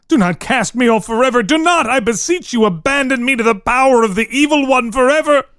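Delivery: cling to her with every word; desperate pleading. Acted. Antoine begs Marie to stay, desperate and pitched high.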